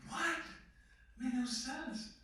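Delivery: high-pitched, incredulous "What?"; baffled disbelief. Retold or imitated. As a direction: react in mock bewilderment, voice jumping up. Acting out his baffled reaction.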